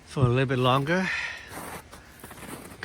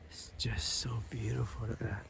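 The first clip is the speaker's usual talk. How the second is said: whispering; 2 semitones lower, 11 dB quieter than usual. Real, not acted. Hushed awe at the view.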